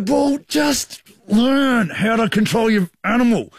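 high, slow, cutting retort; cranky retort. Retold or imitated. Telling the dog owner off, slow and scornful. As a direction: drag each word out in contempt. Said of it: "I'm cranky".

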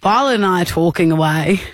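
normal talking voice

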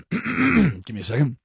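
ordinary talk

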